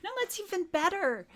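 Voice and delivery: high, bright insistence; eager. Acted. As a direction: push back with excitement.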